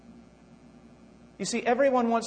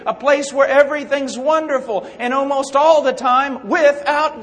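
louder, higher sermon voice; fiery preaching; 4 semitones higher, 6 dB louder than usual. Acted. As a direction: drive it home to the congregation. Preaching at full force.

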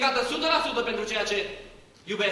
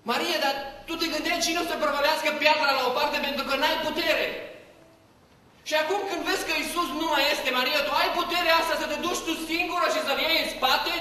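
higher, louder wondering questions to Maria; amazed appeal; 7 semitones higher, 4 dB louder than usual. Real, not acted. Preacher marvelling at Maria's strength, raised voice. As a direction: call out to her in wonder. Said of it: "preacher addresses Maria directly, marvelling".

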